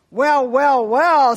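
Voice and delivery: loud, high, admiring "well, well, well"; a dad making a big fuss over her art; impressed father. Acted. Father admires daughter's artwork.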